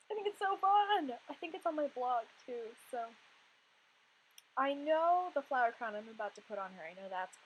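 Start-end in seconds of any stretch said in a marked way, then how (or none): 0.1-1.1 excited. Real, not acted. Repeats line with excitement.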